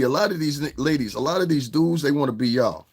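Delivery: ordinary talk, nothing marked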